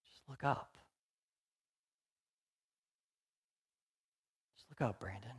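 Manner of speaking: a very soft, tender call to look up; gentle whisper-soft. Retold or imitated. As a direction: coax him gently. Jesus voiced softly.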